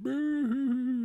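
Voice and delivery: high, slow boo-hoo crying; hammed-up fake sobbing; mock sobbing. Mocking the sad ending.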